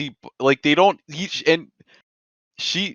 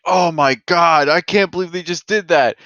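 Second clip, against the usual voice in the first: higher, louder mock disbelief; shocked reader; 6 semitones higher, 5 dB louder than usual. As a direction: exclaim it like a stunned reader. Acted reaction of a shocked reader.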